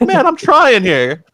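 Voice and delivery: high, drawn-out mock protest; exasperated dad. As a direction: imitate a dad pleading his case, frustrated. Dad defending himself when scolded.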